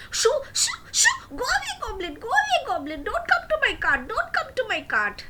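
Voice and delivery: high, loud shoo, go away; angry shooing. Acted. Angry fishmonger chasing the goblin off, high and loud. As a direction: drive the goblin off, shrill and cross. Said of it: "got very angry".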